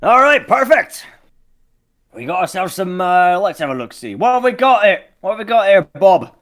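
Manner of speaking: high, loud merchant hail across the water; shouted, hearty ship-to-ship call. Acted. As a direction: holler over to a crewmate. Merchant captain calling between boats.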